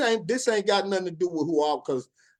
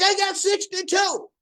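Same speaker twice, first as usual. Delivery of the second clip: high, lightly mocking woman; hint of a prissy customer; mock lady voice; 12 semitones higher than usual. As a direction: squeak it like the smug lady. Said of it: "She's like".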